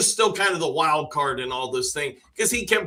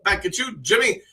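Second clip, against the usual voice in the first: ordinary delivery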